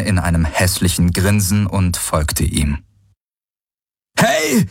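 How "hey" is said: hoher, lauter Ruf hinterher; Gebrüll. A narrator's reading. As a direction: ihnen wütend nachbrüllen. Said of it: "brüllte Galen ihnen nach". (Galen brüllt den Gehenden hinterher)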